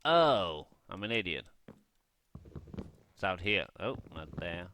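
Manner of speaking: normal talking voice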